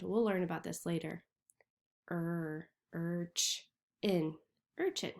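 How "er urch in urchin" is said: er, urch, in, then urchin; syllable by syllable. Shown as a demonstration. Teacher breaking urchin into parts. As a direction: chop it up then say it whole. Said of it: "U R makes the er sound".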